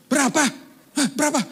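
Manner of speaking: high, loud anxious pressing; frantic asking. Retold or imitated. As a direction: demand the number in a panic. Pressing wife for bill amount. (mendesak dengan cemas, suara tinggi)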